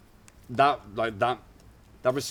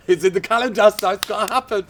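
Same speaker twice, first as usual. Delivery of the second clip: laughing; 12 semitones higher, 11 dB louder than usual. Real, not acted. Joking about scheduled crying.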